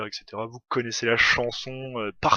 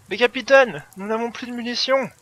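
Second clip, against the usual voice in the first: high, loud hammed-up soldier voice; mock panicked soldier; 11 semitones higher, 7 dB louder than usual. Soldier to captain about ammunition, mock alarm. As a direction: play a soldier alerting his officer.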